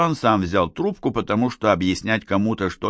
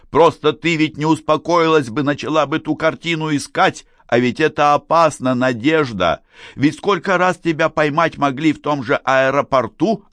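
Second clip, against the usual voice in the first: higher, agitated scolding; raised voice; 8 semitones higher, 4 dB louder than usual. A narrator's reading. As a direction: raise your voice and argue back. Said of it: "настал черёд Гарика повышать голос". (на повышенных тонах, с укором)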